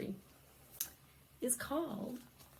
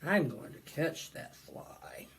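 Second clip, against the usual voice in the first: scheming hippo; 6 semitones lower, 3 dB quieter than usual. Acted. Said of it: "and says to himself".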